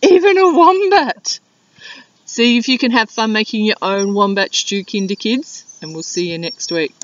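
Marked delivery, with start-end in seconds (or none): 0.0-1.4 excited. Acted. Echoes child, high loud.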